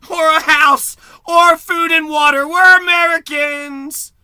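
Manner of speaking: slow, loud mock boast of the careless American; mock proud shout. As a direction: bellow it like a smug loudmouth American. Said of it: "Americans would just be like".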